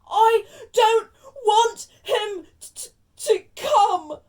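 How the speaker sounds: hammed-up wail, high, loud and slow; yelling, pretend sobs. Spoilt son protesting to his mother, fake-sobbing yell. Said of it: "yelled between huge pretend sobs".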